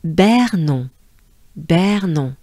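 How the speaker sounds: Bernon with rolled French R and nasal on; French syllable. Shown as a demonstration. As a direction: model Bernon for repetition. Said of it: "the second part is".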